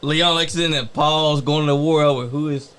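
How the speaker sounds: ordinary delivery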